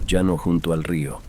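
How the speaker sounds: ordinary delivery, nothing marked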